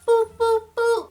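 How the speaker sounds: high-pitched spoken phone beeps; imitated hang-up beeps. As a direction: mimic the line cutting off, high boops.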